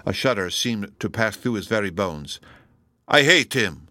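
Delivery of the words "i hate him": louder burst of hatred; vehement muttering. A narrator's reading. Donatello sees the figure in the shadow, muttering with hatred. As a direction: spit it out with loud, heavy force. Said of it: "muttered he".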